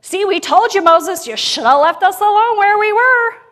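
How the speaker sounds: high, loud whiny shout; mock the griping crowd; complaining crowd. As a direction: Israelites loudly nagging Moses. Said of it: "The people are like".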